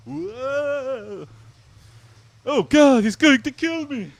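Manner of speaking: very high, loud mock Scandinavian panic; hammed-up Norwegian terror; panicked Norwegian accent. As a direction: yell in the goofy Norwegian voice. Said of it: "my attempt at ... Norwegian".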